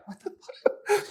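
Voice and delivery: can barely get it out for laughing. Real, not acted. Laughing at pea-throwing date.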